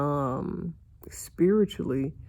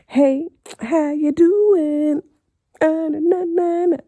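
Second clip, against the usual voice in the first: singsong greeting; 12 semitones higher, 12 dB louder than usual. Acted. Playful greeting to viewers.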